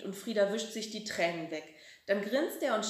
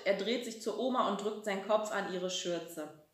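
usual speaking voice

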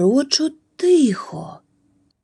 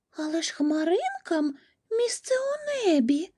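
very high, small squirrel voice, softened; a tiny, high-pitched protest, kept soft; crying-out squirrel; 10 semitones higher, 8 dB quieter than usual. A narrator's reading. Squirrel protests about falling clouds, high restrained cry. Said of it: "закричала білка".

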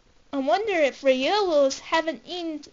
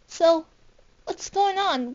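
usual speaking voice

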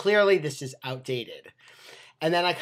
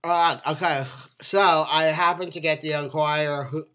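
speaking around tobacco wad; talking with tobacco in lip; 3 dB louder than usual. Real, not acted. Seen 2: Put pinch in mouth beforehand; dark wad visible on teeth while talking.

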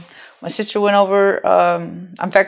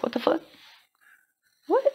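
stunned disbelief; 12 semitones higher, 6 dB quieter, faster than usual. Retold or imitated. Stunned reaction to hearing a voice.

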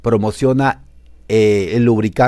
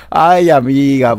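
mock exasperated; 4 semitones higher, 8 dB louder, much slower than usual. Scolding the celebrity.

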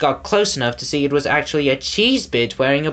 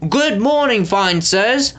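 calling out; 7 semitones higher, slower than usual. A narrator's reading. A crowd member calls a fawning greeting to the lords. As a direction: call out eagerly to the lords. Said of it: "called someone".

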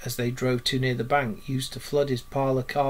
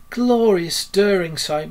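slow, high, swelling voice of rapture; rapturous murmur; 6 semitones higher, 8 dB louder, slower than usual. A narrator's reading. Toad dazzled by the motor car, slow rapturous delivery. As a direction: savour it slowly, entranced. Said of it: "murmured Toad".

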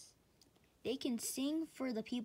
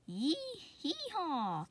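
donkey bray; 8 semitones higher, slower than usual. Acted. Donkey braying in story.

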